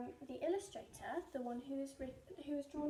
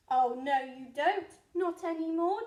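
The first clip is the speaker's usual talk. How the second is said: loud declaration; 13 dB louder than usual. Acted. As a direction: shout it down at the ants. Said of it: "declared the highway thief".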